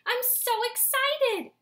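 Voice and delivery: very high, loud and slow rapture; mother's high, slow, loud rapture; rapturous sigh. Acted. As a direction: swoon over the egg. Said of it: "She sighed again".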